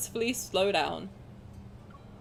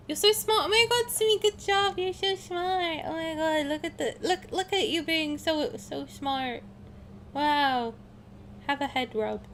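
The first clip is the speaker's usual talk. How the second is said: gushing praise; 6 semitones higher than usual. Pretend. Streamer praising Sumi for being so smart, gushing. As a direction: coo proudly in a high, doting voice.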